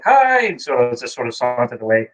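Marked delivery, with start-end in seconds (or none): 0.0-0.5 mock shy hi. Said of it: "he goes like".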